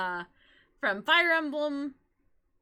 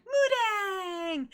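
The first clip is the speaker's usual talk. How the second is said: high, drawn-out joyful call; long gleeful cry, pitched way up; happy squeal; 9 semitones higher than usual. Retold or imitated. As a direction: imitate an adoring fangirl squeal. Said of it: "being all happy".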